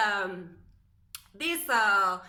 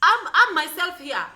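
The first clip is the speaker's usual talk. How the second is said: much louder and higher, standing her ground; defiant outburst; 6 semitones higher, 11 dB louder than usual. Real, not acted. To viewers who mock her English, a loud defiant outburst. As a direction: declare it loud and proud.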